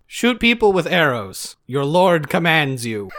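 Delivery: hammed-up holy command; mock voice of God. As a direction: proclaim it like a booming deity.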